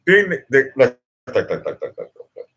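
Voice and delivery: rapid-fire stuttering repetition, falling away; tripping over words. Real, not acted. Streamer getting tongue-tied mid-thought, stuttering. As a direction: rattle out the repeats like a stuck record. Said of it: "Let me slow it down here".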